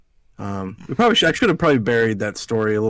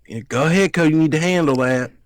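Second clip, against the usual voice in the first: higher, louder mock-Southern urging; dramatic Southern aunt; 6 semitones higher, 4 dB louder than usual. Nephew mimicking aunt telling Cody to handle it, dramatic Southern accent. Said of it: "so fucking dramatic".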